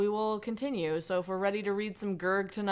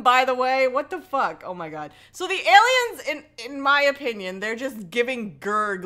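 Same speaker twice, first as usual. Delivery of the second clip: ordinary delivery, nothing marked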